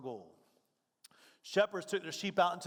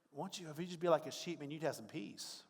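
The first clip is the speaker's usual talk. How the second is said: gentle advice; 2 semitones lower, 7 dB quieter than usual. Retold or imitated.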